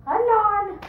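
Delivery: loud, high stage call to the door. Acted. Porter answers knocking, calling out.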